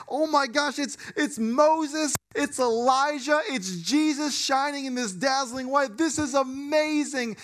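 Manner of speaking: high, slow, wide-eyed gasp of wonder; awestruck. Retold or imitated. Stunned disciples marvel at Moses and Elijah, awestruck.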